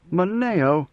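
ordinary delivery, nothing marked

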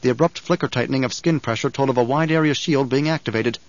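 ordinary delivery

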